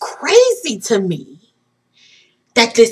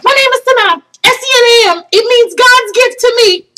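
high, loud, tearful recital; overwhelmed blurting; 12 semitones higher, 6 dB louder than usual. Retold or imitated. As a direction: blurt it out through excited tears. Blurts out name, spelling it, overwhelmed.

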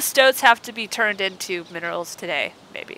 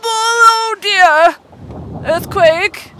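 mock alarm; 9 semitones higher, 7 dB louder, much slower than usual.

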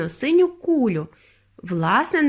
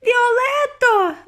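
very high, loud, drawn-out name; horrified scream; 14 semitones higher, 5 dB louder, much slower than usual. A narrator's reading. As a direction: scream for your daughter in horror. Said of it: "закричала пані Борегард".

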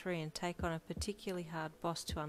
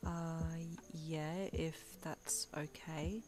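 quieter and slow, hesitant; softly; 5 dB quieter, much slower than usual. A narrator's reading. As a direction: answer shyly, barely there. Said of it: "Butterfly replied softly".

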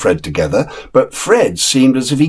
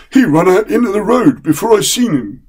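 higher, deferential servant's voice; respectful chauffeur; 11 semitones higher than usual. Acted. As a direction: plead innocence politely to the young master. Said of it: "said the chauffeur respectfully".

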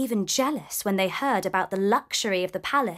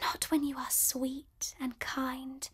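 slow, much softer whisper; tender whisper; 2 semitones higher, 11 dB quieter than usual. A narrator's reading. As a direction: soothe him gently. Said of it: "she whispered".

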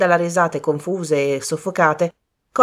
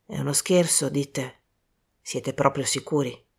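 low, soft, doubtful questioning; understated grave doubt; worried; 4 semitones lower, 6 dB quieter than usual. A narrator's reading. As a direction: ask quietly, with concern underneath. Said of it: "Li guardò impensierito".

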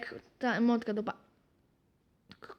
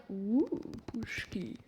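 normal talking voice

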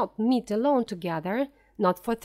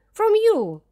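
much higher, louder shout; 11 semitones higher, 8 dB louder than usual. A narrator's reading. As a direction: scream it at her. Flora rejects governess.

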